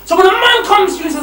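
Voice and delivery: loud, rising sermon voice; fiery preaching. Acted. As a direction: preach it hard at the crowd. Preacher at full force.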